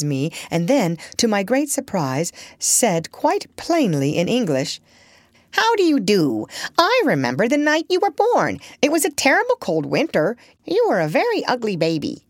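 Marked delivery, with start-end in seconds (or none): 5.5-12.2 a lightly voiced talking parrot, a bit higher; chatty old parrot, prim and knowing. Parrot Polynesia speaks.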